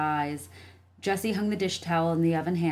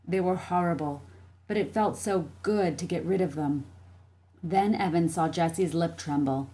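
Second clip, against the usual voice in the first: plain reading voice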